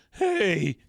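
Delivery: very high, cracking adolescent voice; Jimmy Olsen. Acted. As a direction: squeak it out loudly like an excited cub reporter. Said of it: "don't you hear his voice every time".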